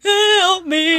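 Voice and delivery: mock crying plea. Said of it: "Like, crying".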